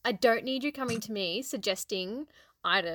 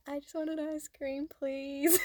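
put-on like a child; 4 semitones higher, 4 dB quieter than usual. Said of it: "Like the child that I am".

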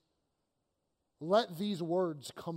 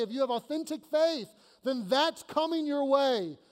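higher, louder promise in full preaching force; voicing Jesus, fervent; 5 semitones higher, 3 dB louder than usual. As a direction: declare each promise to the congregation. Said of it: "He's saying".

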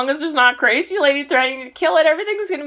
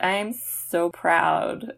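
underwhelmed wife; 6 semitones lower, 7 dB quieter, much slower than usual. Acted. Voices the wife's underwhelmed reply.

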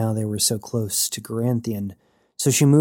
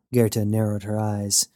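plain reading voice

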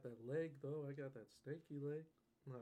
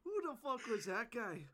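mock shout; 9 semitones higher than usual. Friend repeats the yell.

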